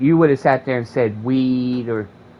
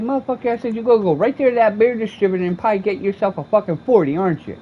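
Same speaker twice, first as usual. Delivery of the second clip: higher, fast, raised taunt; mock accusation; 9 semitones higher, faster than usual. Taunts man asking for change.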